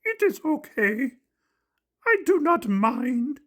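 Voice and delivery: high, slow furry-monster voice, sadly brave; slow, high puppet voice with a sad brave edge; sad Grover. Acted. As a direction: swallow the hurt and say it's fine, slow and high. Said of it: "said Grover sadly".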